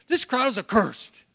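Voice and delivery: mildly angry mock retort; lightly acted scornful outburst; firing back, acted. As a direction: fire back like an affronted official. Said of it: "they're just firing back".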